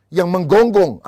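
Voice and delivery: mock barking nag; barking emphasis. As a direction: punch menggonggong loud and hard. Husband complains wife barks at him.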